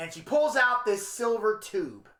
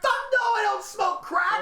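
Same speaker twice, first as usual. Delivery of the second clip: high, appalled denial; indignant outburst; 14 semitones higher, 3 dB louder than usual. Retold or imitated. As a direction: deny it outraged. Reacting to crack offer.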